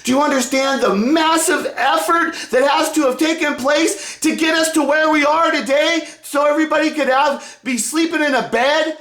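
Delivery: high, fired-up scolding; angry rant. Retold or imitated. Republicans berating the Democrats, in a high angry rant. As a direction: rip into them for being ungrateful. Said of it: "the Republicans are all".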